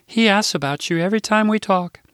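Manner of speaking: put-on high, eager older Midwestern lady; chirpy assistant butting in with news; Rose's voice. Rose speaking fondly.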